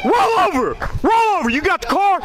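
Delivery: high, forceful police yell; shouting commands. Real, not acted. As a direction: bark orders at the suspect. Officer orders suspect down.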